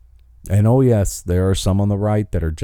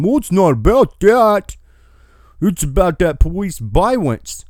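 mock Don Lemon; 7 semitones higher, 3 dB louder than usual. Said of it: "Don Lemon going".

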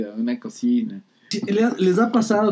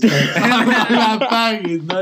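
laughing; 5 semitones higher, 5 dB louder, much slower than usual. Real, not acted. Laughing repeat.

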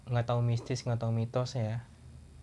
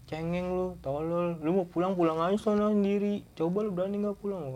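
scolding; 6 semitones higher than usual. Retold or imitated. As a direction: shame your tattooed friend for crying. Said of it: "Gua kata gitu".

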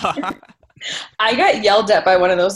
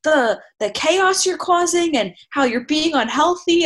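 high, mock scolding lecture; imagined scolding lecture; 9 semitones higher than usual. She imagines lecturing boys who ignore distancing, in a high scolding voice. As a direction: play the scold telling off the boys. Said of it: "be like".

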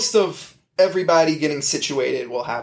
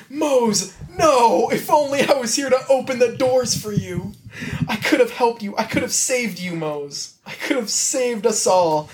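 high, mock-anguished wail; hammed-up grief; 6 semitones higher than usual. Dwight mourning Mose at the burnt farm, high mock anguish. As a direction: ham up a tragic lament.

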